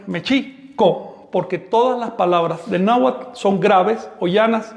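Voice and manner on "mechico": "mechico" very slow, stress on chi. Shown as a demonstration. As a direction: lean on the middle syllable of "mechico", slowly. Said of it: "el acento recae sobre la I". ("mechico" lento, acento en la i)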